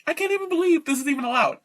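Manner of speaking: incredulous fast outburst; outraged. Real, not acted. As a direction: spit out the outrage quickly.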